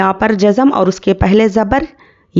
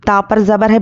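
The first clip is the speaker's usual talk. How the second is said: ordinary talk, nothing marked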